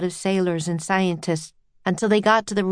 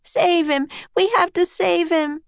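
high desperate plea; crying out; 10 semitones higher than usual. A narrator's reading. Tearful Annie begging Jack to save him, crying out. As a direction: implore in a high urgent voice. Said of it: "cried Annie".

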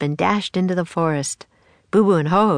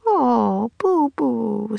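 put-on higher, gentle little girl's comfort; soothing; 6 semitones higher, much slower than usual. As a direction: coax a scared friend gently. Annie comforts scared gorilla.

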